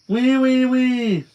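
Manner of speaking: high, loud mock piggy squeal. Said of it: "Boone said again".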